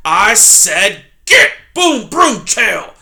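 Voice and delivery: very loud, slow angry shout; very loud slow porcupine roar; furious porcupine shout. Acted. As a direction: drive the fox off. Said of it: "Poppy heard Aerith cry".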